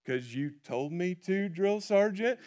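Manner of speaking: bewildered Forrest. Retold or imitated. Forrest puzzled at the sergeant's question. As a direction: answer like it's obvious. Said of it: "bewildered look on his face and goes".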